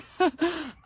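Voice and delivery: high nervous giggle; nervous laugh. Real, not acted. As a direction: burst into a startled giggle. Laughs after bee scare.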